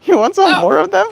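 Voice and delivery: high, eager bird voice rising up; voicing the bird. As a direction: squeak the question like a thrilled little bird. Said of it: "You're like".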